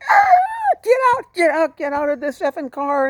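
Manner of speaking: high, loud screaming order; enraged driver. Retold or imitated. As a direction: yell it like a man throwing someone out. Angry driver throwing passenger out.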